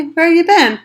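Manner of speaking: ordinary delivery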